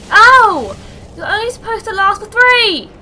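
high, loud indignant outburst; yelp, then rant at the game; pained yell. Real, not acted. Gamer hit by the boss, yelling indignantly.

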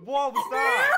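shocked shout. Real, not acted. Reacting to ear-splitting noise.

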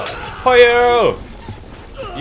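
long, high battle cry; drawn-out shout. Real, not acted. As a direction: stretch it out and yell. Throwing fire at enemy.

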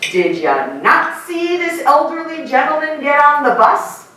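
indignant scolding, rising high; outraged lecture to a teen; mom lecture. Retold or imitated. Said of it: "will go over to that teenager and say".

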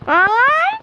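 high startled yelp; sharp yelp. Real, not acted. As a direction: yelp as if hit. Sudden pained cry.

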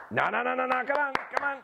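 very high quick no's then call; high-pitched redirect. Real, not acted. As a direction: steer the dog with a high voice. Redirecting dog, then recall.

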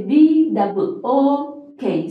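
B double O K, said slowly letter by letter; spelled out. Shown as a demonstration. Teacher spelling book for someone who hasn't understood, slow and distinct. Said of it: "So you'll spell it out".

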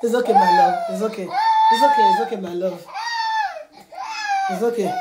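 squeaky, very high "it's okay" with little shouts; high squealy comforting. Retold or imitated. Mother voicing a squeaky soother to her crying baby, high and squealy. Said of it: "He said".

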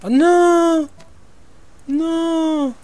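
cri aigu et très fort; cri de désespoir. Real, not acted. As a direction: hurle de détresse, voix qui monte très haut. Découvre sa poule morte. (cri de détresse)

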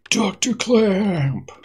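high, slow drawn-out shout; long loud call. Acted. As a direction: call across the lab, stretching it. Calling out, second louder call.

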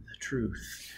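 breathy whispered lines; feed him the line in a whisper; whispered prompt. Acted. Robin whispering the witty answer to Foyle.

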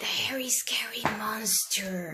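soft unvoiced whisper. Real, not acted. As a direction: breathe the title in a whisper. Adult whispers the title back.

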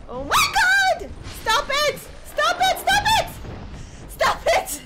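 shrill, loud repeated yells; outburst. Real, not acted. Streamer losing a fight to the undead, yelling. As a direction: yell stop it over and over. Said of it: "I apologize for that outburst".

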